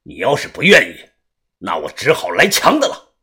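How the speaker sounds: low, controlled menace; cool, low-pitched warning; cold threat. A narrator's reading. Threat after cold narration.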